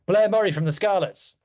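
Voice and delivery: usual speaking voice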